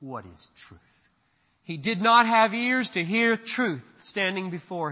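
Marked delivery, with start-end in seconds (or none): 0.0-1.1 Pilate's dismissal. Retold or imitated. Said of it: "that was the end of it".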